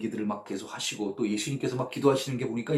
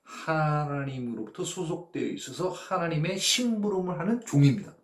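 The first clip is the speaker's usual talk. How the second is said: normal talking voice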